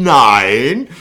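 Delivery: loud, drawn-out no; hammed-up police denial; mock emphatic refusal. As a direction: imitate an officer rejecting it, long and loud. Retold officer flatly refuses suggestion.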